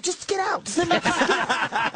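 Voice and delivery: high, hurried get out; impatient shooing. Retold or imitated. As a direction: shoo him away, half laughing. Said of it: "He's like".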